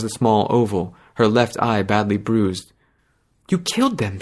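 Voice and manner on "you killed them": stunned. Acted. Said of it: "she said".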